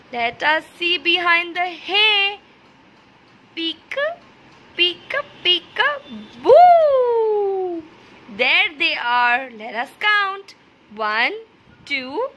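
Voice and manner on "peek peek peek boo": peekaboo. Shown as a demonstration. Teacher playing peekaboo with hidden piglets, boo burst. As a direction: build up and pop the boo.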